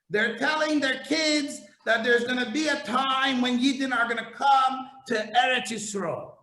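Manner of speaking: ordinary talk, nothing marked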